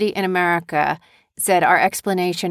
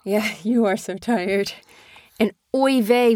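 normal talking voice

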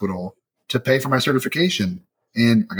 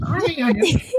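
much higher, put-on old-timer voice; mock old-timer; 14 semitones higher than usual. Shown as a demonstration. Host mocking own age asking about youngsters, mock old-timer. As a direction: ham up a fussy old person. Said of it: "I feel so old saying that".

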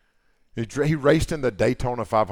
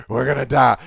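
mock panic, a touch louder; panicked disciples; 2 semitones higher, 8 dB louder than usual. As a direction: overdramatic alarm, lightly played. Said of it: "They're like".

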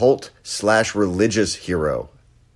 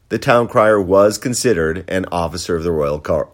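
usual speaking voice

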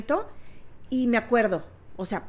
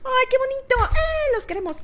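high, gushing thanks; gushing delight; 14 semitones higher than usual. Real, not acted. Thanking the podcast listeners, high excited gushing. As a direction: beam at the listeners and gush.

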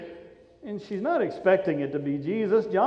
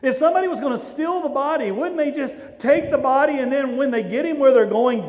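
ordinary delivery

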